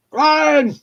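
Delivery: high, loud call across a room; imitated shout. Woman calling her colleague across the warehouse, shouting. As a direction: holler the name to get his attention. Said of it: "she shouts down".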